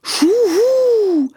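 high hooting owl call. Acted. Owl hoots again before answering, high. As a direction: hoot like an owl. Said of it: "machte der Uhu wieder".

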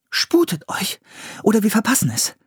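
high-pitched, pressing urgency; pressing appeal, pitched well up; urging. A narrator's reading. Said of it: "drängte Priscilla".

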